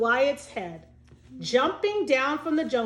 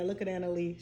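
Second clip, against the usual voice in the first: ordinary delivery, nothing marked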